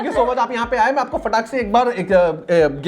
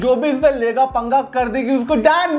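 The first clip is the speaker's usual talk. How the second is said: high-energy host intro with long drawn-out words; hype show intro; 3 semitones higher than usual. Acted. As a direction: announce the guest in a high, chanting hype voice. (जोशीले host का खिंचा हुआ परिचय)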